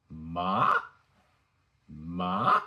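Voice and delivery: rising MA, like climbing a hill; second tone. Shown as a demonstration. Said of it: "repeat the first ten second tones".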